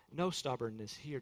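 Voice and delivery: ordinary delivery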